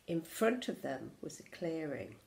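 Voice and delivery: plain reading voice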